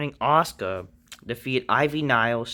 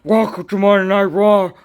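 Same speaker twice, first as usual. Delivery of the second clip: loud, higher mock Austin yell; Austin impression; 9 semitones higher, 10 dB louder than usual. Vlogger imagines Austin saying the catchphrase, loud mock yell. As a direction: bark it out like a brawler. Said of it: "you don't see Austin's like".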